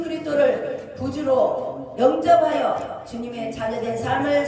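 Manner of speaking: loud, fervent pulpit delivery; preaching at full force. Acted. Preacher urging the congregation to repent, fervent preaching. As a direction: proclaim it to the whole church.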